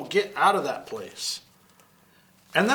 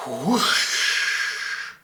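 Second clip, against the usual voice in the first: whoosh sound. Acted. Water gushes from the hose.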